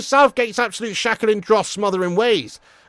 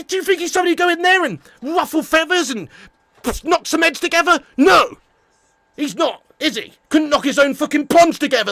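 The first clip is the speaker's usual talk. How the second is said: ordinary talk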